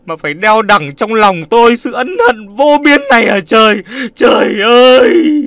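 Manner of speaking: high, loud, wrenching lament; wailing grief. Acted. Nam kneeling at Phượng's mother's feet, wailing in guilt. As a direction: howl it out, begging to be punished. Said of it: "giống lên một tiếng thê lương".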